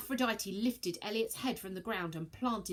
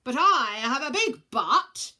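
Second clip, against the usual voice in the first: gruff joke; 6 semitones higher, 12 dB louder, slower than usual. Acted. Comic gruff council member.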